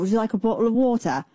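quick imitated English accent. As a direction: do a brisk English accent, offering a drink. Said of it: "in his accent".

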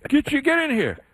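high-pitched imitated summons; New England coworker. Coworker summoning the only Southerner, high mock urgency. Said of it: "one of my New England coworkers".

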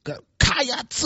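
high, stretched Go Cats; mock Kentucky cheer. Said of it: "is that your Kentucky fan".

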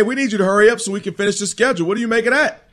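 normal talking voice

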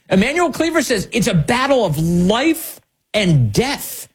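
slow, high mocking restatement; mock disbelief. Real, not acted. Host mocking Cleaver's quote, slow and high. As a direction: stretch it out in disbelief.

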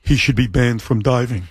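low monotone imitation, lightly done; low monotone Ernie. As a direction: drone it low and level. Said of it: "I'll do an Ernie imitation, he had a very low monotone voice".

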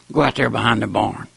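usual speaking voice

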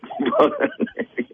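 a sudden high laugh bursting out; high burst of laughter. Real, not acted. Prank revealed, caller bursts out laughing.

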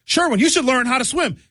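high, singsong advice; mocking people who tell him to learn to swim; mock nagging voice. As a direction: talk down to Sherwin like a pushy helper. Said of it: "people saying".

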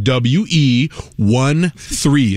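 "W E one three" very slowly, each piece separate; drawn-out letters and single digits; spelled out. Retold or imitated. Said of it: "two letters can't make a word".

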